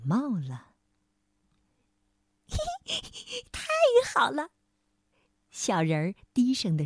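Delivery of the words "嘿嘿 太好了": high, squeaky gleeful chuckle; delighted little creature. A narrator's reading. Little man hears the king needs him, delighted squeak. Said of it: "月光小人儿高兴地跳了起来".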